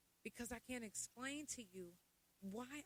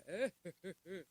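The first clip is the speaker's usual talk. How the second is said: loud imitated chuckle at usual pitch; mock laugh; 9 dB louder than usual. Mimicking friends carrying on, loud mock chuckle. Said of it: "Why y'all all like".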